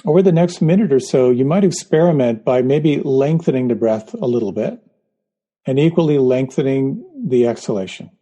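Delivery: guided meditation. Acted. Leads a guided breathing meditation.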